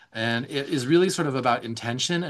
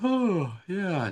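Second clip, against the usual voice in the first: relaxed sigh; 7 semitones higher than usual. Retold or imitated. Therapist voicing a client settling into rest, contented. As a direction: let it out like you're sinking into rest.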